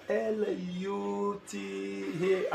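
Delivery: slow letter by letter L U T A; careful slow spelling. Shown as a demonstration. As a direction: spell each letter slowly and clearly. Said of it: "I begin to spell it".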